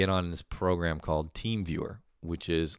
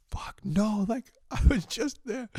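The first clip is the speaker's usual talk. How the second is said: much higher, mock-panicked voice; panicked customer; 14 semitones higher, slower than usual. Customer losing tech support: mock panic, much higher. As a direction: squeal it like a frantic caller.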